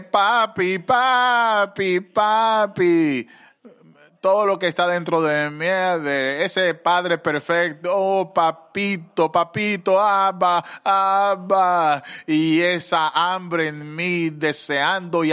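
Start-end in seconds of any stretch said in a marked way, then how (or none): 0.0-3.3 high, louder pleading cry of papi; a groaning plea, voice pitched much higher. Retold or imitated. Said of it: "comencé a gemir". 7.9-12.0 a slow, yearning cry, pitched much higher; groaning longing. Retold or imitated. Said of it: "comencé a gemir".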